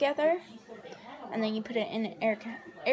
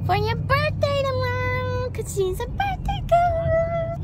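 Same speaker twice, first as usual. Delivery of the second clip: excited; 13 semitones higher, 7 dB louder than usual. Real, not acted. Teasing birthday girl.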